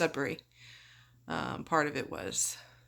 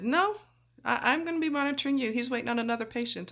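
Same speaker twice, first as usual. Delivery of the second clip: nurse voice; 7 semitones higher, faster than usual. Retold or imitated. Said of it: "my nurse said".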